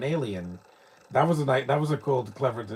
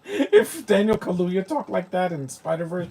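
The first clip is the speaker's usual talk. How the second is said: higher pitch, talking through laughter; laughing; 7 semitones higher, 4 dB louder than usual. Real, not acted. Streamer joking about an actor's voice in Spider-Verse, laughing. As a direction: start the sentence still cracking up. Laughing after own mock voice.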